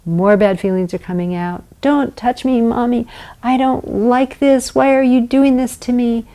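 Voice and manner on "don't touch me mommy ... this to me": put-on upset child. Shown as a demonstration. Child voiced resisting mother.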